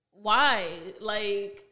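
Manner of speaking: sudden loud indignant Why; exasperated why. Retold or imitated. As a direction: bark it out in disbelief at him. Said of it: "I'm like".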